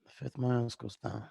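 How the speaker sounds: soft tough-guy impression; Bruce Willis. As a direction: answer quietly, in character. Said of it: "I've never heard your Bruce Willis".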